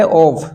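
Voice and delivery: ऑफ ending in a clear f sound; double-f "off". Shown as a demonstration. Teacher adding an f for students, ऑफ said with final f. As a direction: finish ऑफ on f, not v. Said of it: "इसमें एक एफ और लगा दो".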